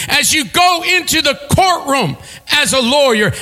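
rising, driving preacher cadence; ringing sermon build; preaching at full force. Acted. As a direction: hammer each "as you go" line.

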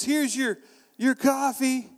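chirpy handoff of the wrong coffee; sweet barista voice. Retold or imitated. Barista handing him a coffee, in a high sweet voice.

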